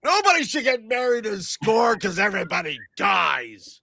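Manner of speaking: high-pitched yelled proclamation; hollered warning to the whole room; yelling out. Acted. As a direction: pontificate at the top of your register. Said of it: "I start just yelling out".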